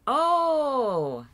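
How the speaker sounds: high, loud, drawn-out oh; a long exclamation of surprise; surprised. Real, not acted. Streamer reacting to the live chat, surprised.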